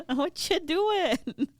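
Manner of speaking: high, drawn-out incredulous question; exasperated girlfriend, half laughing; mock disbelief. Girls goad their bashful friend.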